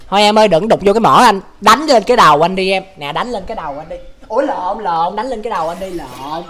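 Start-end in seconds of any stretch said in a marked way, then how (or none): 3.0-6.4 much softer, trailing away; quiet, as if caught slipping up; hushed joking repeat. Pretend. Joking slip, repeats line quietly.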